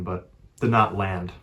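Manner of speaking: ordinary delivery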